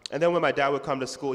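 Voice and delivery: ordinary talk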